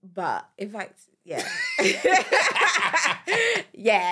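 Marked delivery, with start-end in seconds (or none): 1.8-4.1 slow, high, loud laughing wrap-up. Real, not acted. Wife laughing off their DIY planking, loud and slow. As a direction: laugh it off and drop the subject.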